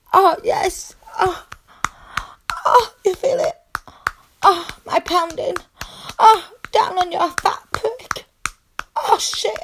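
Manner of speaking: high, loud cries of pleasure; ecstatic moaning. Acted. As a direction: cry out, building to climax. Climbing toward climax.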